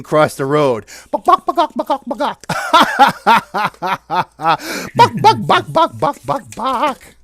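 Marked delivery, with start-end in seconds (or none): 1.1-2.4 high, rapid-fire chicken clucks; high squawky puppet chicken; magic chicken. Acted. Chicken crossing the road. 2.5-4.9 long chicken-like cackle; repeated sharp squawking laugh; high staccato cackle. Acted. 4.9-7.1 high, rapid-fire chicken clucks; high squawky puppet chicken; magic chicken. Acted.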